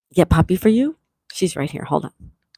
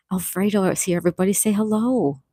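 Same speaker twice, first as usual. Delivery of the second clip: normal talking voice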